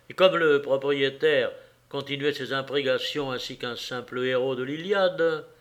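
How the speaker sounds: ordinary delivery, nothing marked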